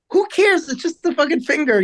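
put-on telling girlfriend a sore finger doesn't matter; dismissive. Retelling his defiant answer.

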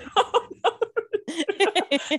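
high, drawn-out laugh; helpless high-pitched laughing fit. Real, not acted. Cracking up over the accent banter, high laughter.

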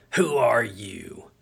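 put-on raspy, slow, whispered old woman. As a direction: rasp it out like a suspicious old lady. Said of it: "A raspy old voice said".

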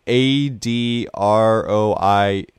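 slow spelling, letter by letter. Shown as a demonstration. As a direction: spell it out slowly.

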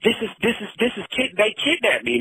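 ordinary talk, nothing marked

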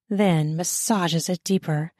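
ordinary delivery, nothing marked

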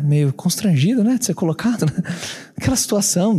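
ordinary talk, nothing marked

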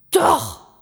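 high, loud yelled contradiction; angry shout. A narrator's reading. Gram contradicts the raccoon dog, angry shout. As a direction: yell back stubbornly. Gram keeps yelling in quarrel.

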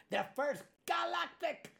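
put-on slow, high, thin old voice; creaky ancient emperor voice; very old man. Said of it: "me gusta hacer la voz del abuelo".

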